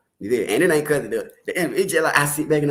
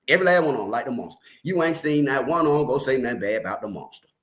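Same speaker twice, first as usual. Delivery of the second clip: normal talking voice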